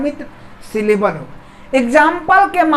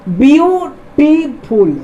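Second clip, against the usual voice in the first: Beautiful slowly in three beats, view … टी … फुल; syllable by syllable; 2 semitones higher, much slower than usual. Shown as a demonstration. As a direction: break beautiful after view and after टी. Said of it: "अब इसका यदि हम उच्चारण करें".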